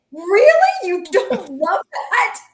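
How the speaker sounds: high, loud, incredulous laughing question; mock surprise. To her friend, high laughing disbelief. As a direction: act shocked anyone could dislike it. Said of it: "and I was like".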